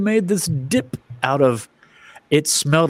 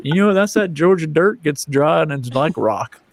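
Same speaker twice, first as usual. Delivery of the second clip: put-on Southern accent; 3 semitones higher than usual. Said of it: "you have to get into a Southern accent".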